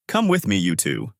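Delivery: usual speaking voice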